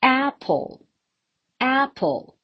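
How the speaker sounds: APple, starting high and falling; stress on first syllable. Shown as a demonstration. Teacher likening it to stairs going down, first syllable stressed. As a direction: hit the "Ap" of Apple, step down on "ple". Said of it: "just like climbing down the stairs".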